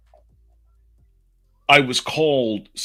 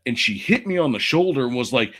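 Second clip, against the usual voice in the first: ordinary talk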